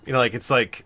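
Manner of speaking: ordinary talk, nothing marked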